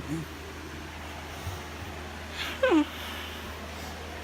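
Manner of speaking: long breathy sigh of despair; heavy sigh. Real, not acted. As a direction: let out a long defeated sigh. Car destroyed, heavy sigh.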